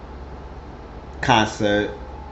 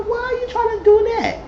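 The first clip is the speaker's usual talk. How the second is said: high mock voice; mock indignant. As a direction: squeak it out indignantly. Voicing [name] indignant.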